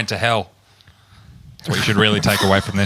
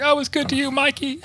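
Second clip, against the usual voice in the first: Danny Trejo's dying plea; 3 dB quieter than usual. Retold or imitated. Friend retelling a movie death scene, mock pleading. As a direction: imitate a tender reproach, pitched way up. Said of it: "And he's like".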